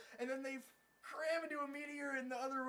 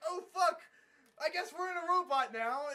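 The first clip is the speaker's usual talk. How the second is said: loud, raised retold exclamation; mock alarm; 6 semitones higher, 9 dB louder than usual. Pilot finds himself in a robot, mock alarm. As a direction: blurt it out in exaggerated dismay.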